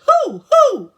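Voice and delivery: high, very loud owl hoots; loud hammed-up hoot; owl hollering. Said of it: "who hollered".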